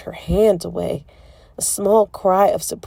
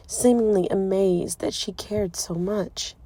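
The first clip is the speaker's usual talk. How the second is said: plain reading voice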